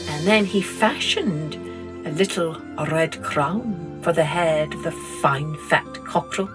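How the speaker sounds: ordinary delivery, nothing marked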